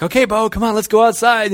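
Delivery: high, loud, coaxing call to a dog. Retold or imitated. Preacher tells his dog to come outside, high eager call. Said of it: "I tell my dog".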